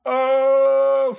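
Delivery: long, loud, high "oh"; mock-outraged howl. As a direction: hold one high note of teasing shock. Mock reaction to gym jab.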